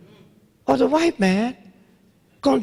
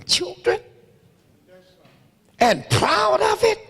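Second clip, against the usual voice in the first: shouting rant; 7 semitones higher than usual. Real, not acted. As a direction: blast it at the crowd in outrage. Ranting at the audience.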